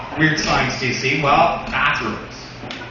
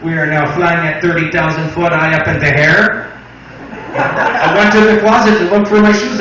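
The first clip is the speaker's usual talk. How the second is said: put-on louder French Canadian accent pilot; 4 dB louder than usual. Comic imitating a French Canadian pilot, hammed-up French Canadian accent. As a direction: ham up the French Canadian captain. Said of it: "Speaking about accents".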